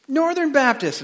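high, eager burst; acted high-pitched excitement; excited answer. Joke: jumper's excited answer.

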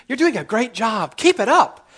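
rising to a high squeal; chirpy cheerful praise. Retold or imitated. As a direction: gush like an eager fan. Imitating cheerful compliment.

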